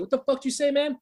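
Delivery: high-pitched hot challenge; a teenage hothead ready to fight; angry challenge. Retold or imitated. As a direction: square up to him, pitched high. Angry teen confronting rockstar.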